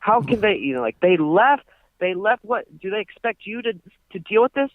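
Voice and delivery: high, hammed-up threats; mock threatening rant. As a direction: threaten like a blustering landlord. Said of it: "he's just like".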